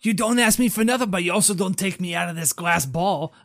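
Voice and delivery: aggrieved and loud; indignant retort. Acted. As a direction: snap back in a quarrel, full voice. Spider argues back in the scene.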